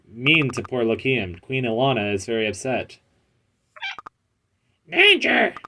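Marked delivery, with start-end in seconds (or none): 4.9-5.5 pet voice. Acted. Voicing pet character in cutscene.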